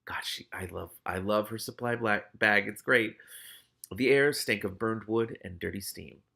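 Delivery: ordinary delivery, nothing marked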